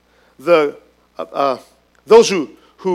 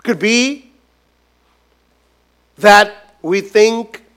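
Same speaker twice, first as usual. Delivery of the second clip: ordinary delivery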